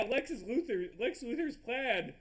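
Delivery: ordinary talk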